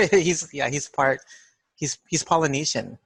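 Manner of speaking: ordinary talk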